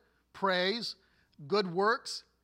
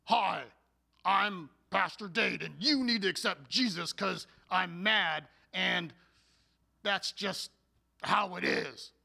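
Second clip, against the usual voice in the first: a hint of a cranky, put-out preacher greeting; mock angry pastor. Pastor mocking an unwelcoming greeting, lightly voiced cranky tone. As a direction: play a grumpy pastor who makes faith sound like a chore. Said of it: "with an attitude that was".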